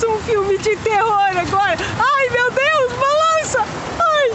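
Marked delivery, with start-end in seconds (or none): 2.0-4.3 scared. Real, not acted. Cable car swaying, scared.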